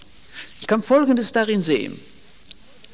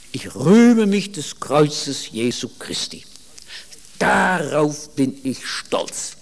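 slow solemn confession; 2 semitones lower, slower than usual. Retold or imitated. Paul's proud confession, slowed.

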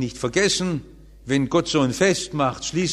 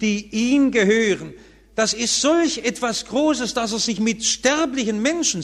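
preaching at full force; 10 semitones higher than usual. Acted. Preacher to his congregation about God's love, fervent preaching. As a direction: proclaim it from the pulpit with awe.

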